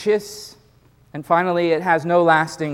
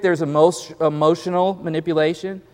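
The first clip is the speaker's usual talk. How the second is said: ordinary delivery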